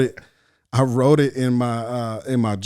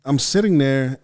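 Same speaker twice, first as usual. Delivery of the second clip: normal talking voice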